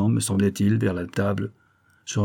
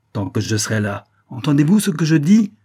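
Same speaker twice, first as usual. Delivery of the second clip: defiant cry; 6 semitones higher, 6 dB louder than usual. A narrator's reading. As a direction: challenge them, rising to a loud, high finish. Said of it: "cria-t-elle sur le ton du défi".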